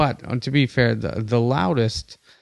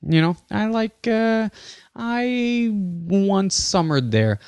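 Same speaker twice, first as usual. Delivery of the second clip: high-pitched snooty traveller, slow and drawn out; posh traveller; 9 semitones higher, slower than usual. Retold or imitated. Imitating a tourist who claims a country, snooty. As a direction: play the worldly tourist bragging. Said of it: "you just go".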